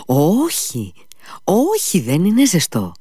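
high, swooping eager denial; eager reassurance. A narrator's reading. Captain assuring Kolia the meat isn't hot, eager reassurance. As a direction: insist it's fine, pitch leaping up.

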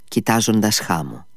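plain reading voice

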